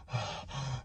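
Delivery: a soft, breathy worried sigh. Retold or imitated. Sighing softly while telling of panicking alone in the sauna. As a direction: sigh out the dread quietly.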